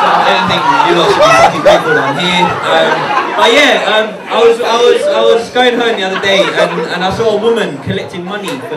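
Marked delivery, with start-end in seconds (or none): 0.1-2.5 mock indignant shout. Mock indignant rant at crowd.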